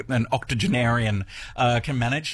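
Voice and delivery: ordinary delivery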